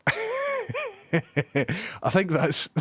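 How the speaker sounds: a long high laugh stretched through the words. Real, not acted. Streamer laughing at his own story, high and stretched. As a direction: laugh helplessly while trying to speak.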